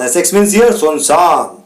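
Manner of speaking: ordinary talk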